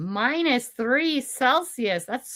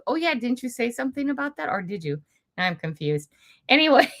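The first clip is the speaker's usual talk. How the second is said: normal talking voice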